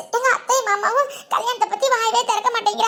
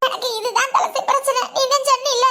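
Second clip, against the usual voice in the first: tearful plea to a friend; upset, sobbing through the words; crying. Acted. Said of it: "அழுகாதடி".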